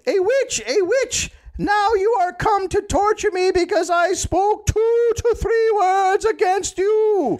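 very high, drawn-out theatrical wail; hammed-up accusing shriek in falsetto; mock crying yell. A narrator's reading. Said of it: "she cried and yelled".

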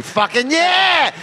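mock triumphant cheer. Cheering at the podcast mics after the Flynn reveal, mock triumphant. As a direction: cheer it like you called it all along.

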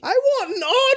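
very high squeaky voice; mock Gwen. Co-host mocking Gwen's taste, very high squeaky voice. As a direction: squeal it like a giddy girl.

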